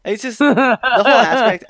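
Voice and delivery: laughing while talking. Real, not acted. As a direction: laugh through the words. Laughing during remark.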